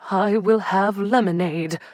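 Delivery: prim matron's firm order. A narrator's reading. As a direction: settle the matter. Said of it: "with a firm nod".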